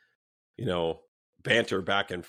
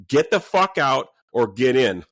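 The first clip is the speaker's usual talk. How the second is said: loud, slow, forceful order; retold ultimatum; 4 semitones higher, 8 dB louder, slower than usual. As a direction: reenact a hard ultimatum, word by word. Retelling his ultimatum.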